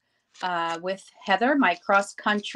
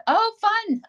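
delighted. Real, not acted. Greets a viewer's news.

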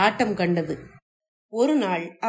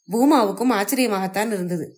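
ordinary delivery

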